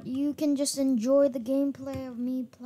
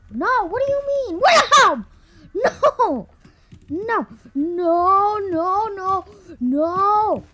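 panicked shouting; 6 semitones higher, 5 dB louder, much slower than usual. Real, not acted. Panicking as the neighbor chases.

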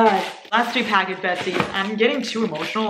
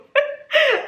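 high laugh; 6 dB louder than usual. Real, not acted. Fan invites her over.

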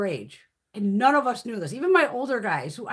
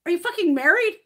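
pitched-up question with rising end; retold incredulous demand; 5 semitones higher, 4 dB louder than usual. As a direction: re-enact an alarmed interrogation, ending high. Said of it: "I'm like".

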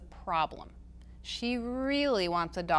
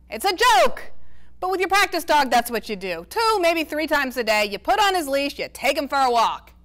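exasperated grandpa; 6 semitones higher, 9 dB louder than usual. A narrator's reading. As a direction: throw up your hands at her. Grandpa Ace voiced in reading.

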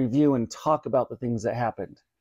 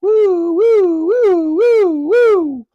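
high, loud siren woo woo; mimicking police sirens overhead; siren wail; 9 dB louder, much slower than usual. A narrator's reading. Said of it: "It sounds like a fleet".

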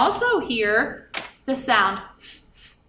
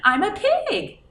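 pig; 10 semitones higher, 4 dB louder than usual. Acted. Animal answer in role.

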